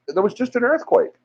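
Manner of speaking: high, frantic, pleading to be believed; frantic insistence. Retold or imitated. Telling the unbothered guys about the quake, frantic and high. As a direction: insist it was real, near panic. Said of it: "I said".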